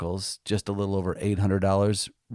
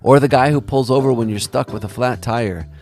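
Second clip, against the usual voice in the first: louder, polished storytelling read over music; a documentary host's heartfelt closing read; podcast narration; 7 dB louder than usual. Acted. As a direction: narrate it warmly to the audience.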